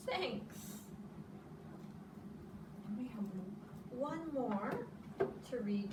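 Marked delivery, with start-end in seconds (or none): 0.1-0.8 gentle thanks. A narrator's reading. Gentle close of story.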